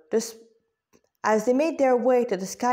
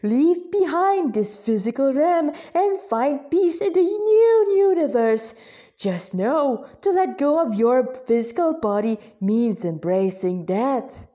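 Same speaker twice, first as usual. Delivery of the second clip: bad Hindi accent; 3 semitones higher, 3 dB louder than usual. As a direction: preach it in the worst Hindi accent you can. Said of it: "the worst Hindi accent in existence".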